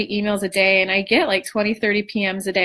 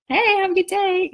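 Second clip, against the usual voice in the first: sing-song, much higher sweet greeting; breezy fake-cheerful hello; mock sugary brightness; chirpy greeting; 9 semitones higher than usual.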